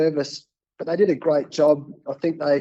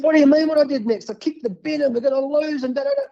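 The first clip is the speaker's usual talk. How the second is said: much higher and faster, disbelieving; incredulous outburst; 11 semitones higher, faster than usual. Retold or imitated. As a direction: fire back in high-pitched disbelief. Incredulous reply to producer.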